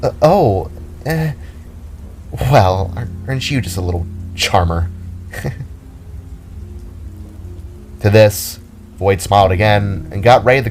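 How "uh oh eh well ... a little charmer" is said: a startled start, then teasing; mildly surprised, flattered. A narrator's reading. Blushing Jada surprised by Voight's compliment, mildly startled. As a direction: caught off guard, then tease him. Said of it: "surprised expression".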